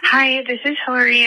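usual speaking voice

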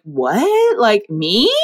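questioning yelp with rising pitch; surprised disbelief. Retold or imitated. Student told by mentor she'll start a company, disbelieving. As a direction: act out your own astonished double-take.

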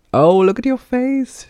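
very high, loud, drawn-out teasing; high-pitched gleeful mockery; chuckling, amused teasing. A narrator's reading. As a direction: crow with amusement at someone's expense. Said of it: "she chuckled".

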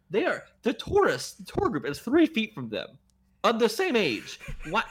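high, exasperated complaint; incredulous protest. Real, not acted. Podcaster questions the security guard's assumption, incredulous.

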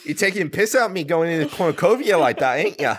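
mock accent, Australian-sounding. Co-host's British accent attempt that comes out Australian. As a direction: rattle it off in broad fake Australian. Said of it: "It was Australian".

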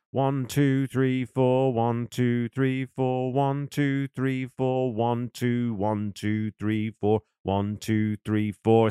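even, chant-like count on a steady note; counting out bars. Shown as a demonstration. Drummer counting the bars into the second verse, steady rhythmic count. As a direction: count ONE, two, three, four evenly on the beat. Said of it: "The count from there, though, is".

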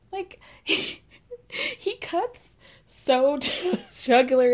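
breaking into laughter mid-sentence; real laughter while retelling the burn; laughing. Real, not acted. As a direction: laugh through the words. Laughing at Stan's remark.

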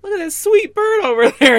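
co-host mimicking the thief distracting Gretchen, hammed up; mock-sweet distraction. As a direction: lure her gaze away with fake charm. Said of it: "he's all like".

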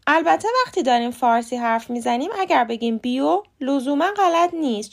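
normal talking voice

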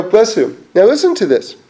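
ordinary talk, nothing marked

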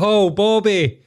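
high mocking neighbour voice with a laugh. Mate mimics the neighbour scolding Bobby, high mocking voice. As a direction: ham up the nagging neighbour.

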